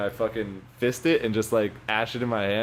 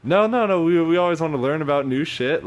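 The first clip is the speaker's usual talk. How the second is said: much higher, louder overly friendly cop; fake-friendly cop; 6 semitones higher, 4 dB louder than usual. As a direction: play the nice cop laying it on thick. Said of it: "he's like".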